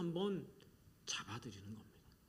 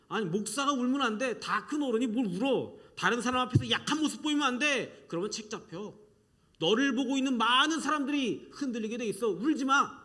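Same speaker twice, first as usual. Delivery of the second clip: higher and louder, rebuking a pastor for crying; stern scolding; 6 semitones higher, 7 dB louder than usual. Acted. Society scolding the pastor, loud stern rebuke. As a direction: bark it like a strict elder.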